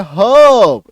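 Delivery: हर्ब as long loud "huhb", no R; silent R. Shown as a demonstration. Teacher demonstrating silent R, हर्ब loud and drawn out. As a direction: stretch the vowel of हर्ब, R dropped. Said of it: "रॉ का silent हो गया".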